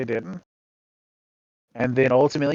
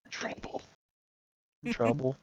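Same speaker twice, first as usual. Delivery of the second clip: hushed aside; 12 dB quieter, slower than usual. Retold or imitated. Retold dread of being in trouble.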